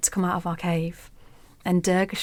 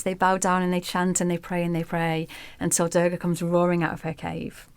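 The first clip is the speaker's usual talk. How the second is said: ordinary talk, nothing marked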